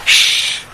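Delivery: long voiceless "shhh"; hushing shush. A narrator's reading. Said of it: "ইশারায় নকুলকে চুপ করতে বলি". (চুপ করানোর শ্শ্)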